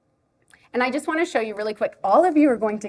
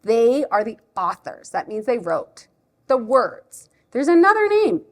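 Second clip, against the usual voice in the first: animated explaining with a surprised lift; lively storytime voice, leaping up at discoveries; reading to a child; 4 dB louder than usual. Shown as a demonstration. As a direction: teach a little one the book cover. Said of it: "read it like I would if a child is here".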